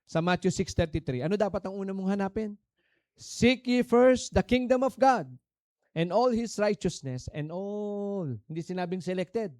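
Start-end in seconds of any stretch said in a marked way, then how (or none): 3.2-8.3 1 booming scripture. Acted. Preacher quoting scripture.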